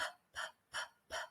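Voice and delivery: unvoiced puff on "p"; just a burst of air; voiceless p. Shown as a demonstration. Teacher drilling the voiceless P sound. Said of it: "practice with that p".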